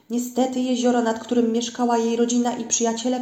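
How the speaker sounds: ordinary delivery, nothing marked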